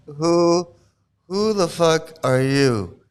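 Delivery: drawn-out, deliberate challenge; slow and spaced out; slow mock challenge. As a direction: stretch each word with mock indignation. Slow mock-angry guru's challenge.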